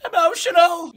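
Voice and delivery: high, loud mock sob; mock emotional cry. Real, not acted. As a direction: overplay being moved. Reacting to chat feels.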